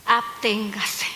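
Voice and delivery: slow, low, weighty "Absténgase"; solemn command. Acted. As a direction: lay down the law gravely. Solemn biblical advice. (mandato solemne, lento y grave)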